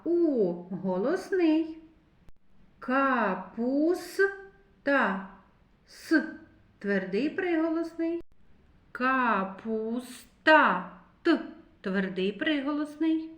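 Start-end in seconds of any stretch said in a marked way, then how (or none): 2.8-4.3 капуста stretched very slowly, sound by sound; drawn-out word. Shown as a demonstration. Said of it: "teacher stretches word per sound". 8.9-10.9 капуста stretched very slowly, sound by sound; drawn-out word. Shown as a demonstration. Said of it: "teacher stretches word per sound".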